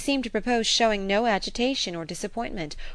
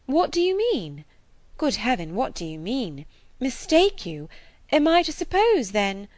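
a touch of rising alarm; 6 semitones higher, 4 dB louder than usual. Acted. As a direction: ask in growing dread. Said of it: "waiting in great terror".

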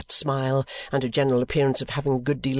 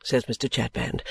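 usual speaking voice

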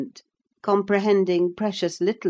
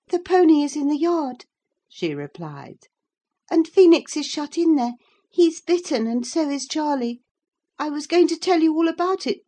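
put-on sulky girl; 10 semitones higher, 4 dB louder than usual. A narrator's reading. Cathy sulky reply.

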